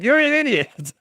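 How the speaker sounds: high, playful fake insult; mock insult. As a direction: tease him with a grin. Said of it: "again, joking".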